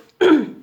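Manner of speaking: sharp loud throat clear. Real, not acted. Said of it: "I started coughing".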